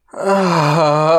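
long, loud moan of pleasure; loud pleasured "ah"; delighted moan. A narrator's reading. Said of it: "She moans delightfully".

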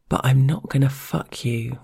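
plain reading voice

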